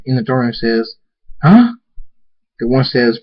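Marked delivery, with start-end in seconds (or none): 1.4-1.7 high, loud startled huh; jolted by a sudden bang; sharp rise in alarm. Acted. Startled crowd reacts to explosion.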